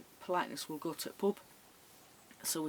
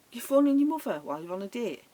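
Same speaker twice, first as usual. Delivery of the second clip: higher, louder incredulous question; mild mock disbelief; 4 semitones higher, 5 dB louder, faster than usual. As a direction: retell your disbelief, pitched up. Her incredulous thought at date.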